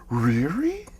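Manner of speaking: a hint of enthusiastic Scooby-Doo; lightly voiced cartoon dog asking; Scooby-Doo-like really. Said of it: "a really enthusiastic Scooby-Doo".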